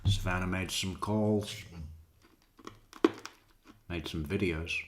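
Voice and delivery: talking while crunching candy; crunching hard candy mid-sentence. Real, not acted. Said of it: "Sorry for the crunchy. It's these damn cherry pastels".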